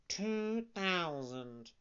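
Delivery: echoing cat. Acted. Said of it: "repeated the cat".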